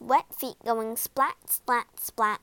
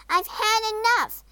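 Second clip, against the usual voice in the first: high, loud, fed-up snap; angry snap; 12 semitones higher, 5 dB louder than usual. A narrator's reading. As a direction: explode at the frog, pitch high. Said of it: "snapped Poppy".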